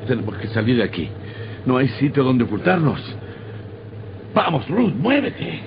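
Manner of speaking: urgent alarm. Acted. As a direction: hurry her out, rising panic. Fleeing the approaching shadow.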